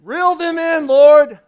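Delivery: loud mock prayer. Pastor loudly mock-praying over the crowd, shouted. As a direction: cry it out to the Lord. Sudden prayer cry to audience.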